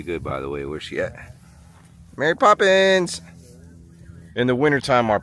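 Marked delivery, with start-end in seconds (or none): 2.2-3.2 high, loud call across the field. Real, not acted. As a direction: call the goat by name. Calling out for goat.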